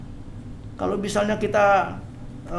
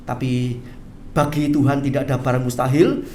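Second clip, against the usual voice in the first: normal talking voice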